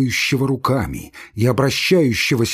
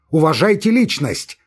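raised, louder demand for respect; indignant demand; 6 semitones higher, 4 dB louder than usual. Acted. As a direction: insist on it, voice up and strained. Laevsky's agitated demand.

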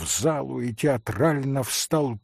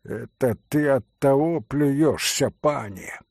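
usual speaking voice

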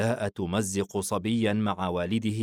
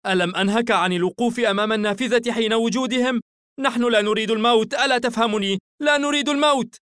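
fast, high, loud scolding building higher; furious rebuke rising to a peak; angry mother; 14 semitones higher, 7 dB louder, faster than usual. A narrator's reading. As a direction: berate a child in urgent panic. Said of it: "دفعته أمه بغضب".

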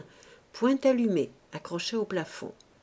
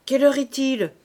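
loud, raised question; brusque interrogation; 7 semitones higher, 8 dB louder than usual. Acted. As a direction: demand it gruffly of the child. Gruff man interrogates child at door.

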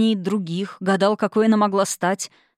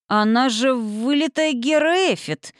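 slow, higher-pitched drawn-out amazement; amazed; 6 semitones higher, slower than usual. A narrator's reading. Mark voices the hero's thought, amazed. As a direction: marvel at the likeness, climbing in pitch.

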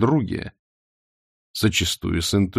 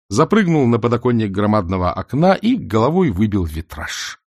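usual speaking voice

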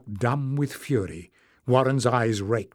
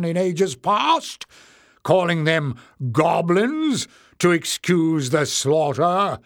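loud, higher, indignant harangue; crying out; 4 semitones higher, 4 dB louder than usual. A narrator's reading. Leader accusing long hairs before the crowd, loud and indignant. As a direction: stir up the crowd against them. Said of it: "Warren cried, turning to face the crowd".

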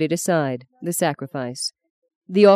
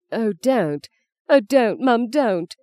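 higher, breaking plea; tearful pleading; 6 semitones higher, slower than usual. Acted. Son pleading tearfully with his mother to stop. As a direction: beg her to stop, close to tears. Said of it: "He wept for her".

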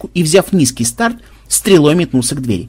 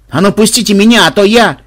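loud, raised-pitch demand; indignant threat; 6 semitones higher, 6 dB louder than usual. A narrator's reading. Captive coming round and demanding release, indignant. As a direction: protest loudly, breaking off the threat.

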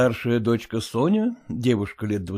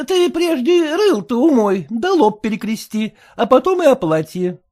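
high, sour, fretful wife voice; high complaining wife; sour merchant's wife; 12 semitones higher, 6 dB louder than usual. A narrator's reading. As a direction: whine it like a sour housewife. Mother voiced.

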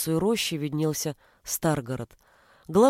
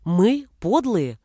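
slow, outraged disbelief; indignant exclamation; 2 semitones higher, 5 dB louder, much slower than usual. A narrator's reading. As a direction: throw the words back in disbelief. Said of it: "возмущённо воскликнул Ежи".

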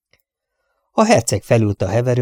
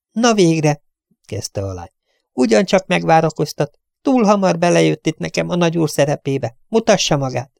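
put-on impatient young lady; 7 semitones higher than usual. Young lady's voice.

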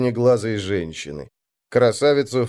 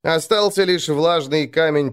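ordinary delivery, nothing marked

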